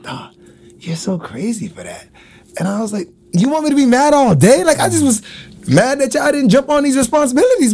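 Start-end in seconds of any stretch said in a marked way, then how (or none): 0.0-2.1 whispered teasing remark; amused whisper. Retold or imitated. Daughter teasing her dad after his joke, whispered. Daughter laughing at him, quoted. 3.3-7.7 higher, incredulous protest; mock exasperation. Dad answering his daughter, higher and incredulous.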